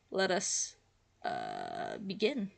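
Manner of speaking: normal talking voice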